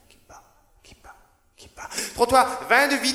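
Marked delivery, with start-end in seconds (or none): 0.1-1.9 whisper=43. Acted. Whispered refrain in poem.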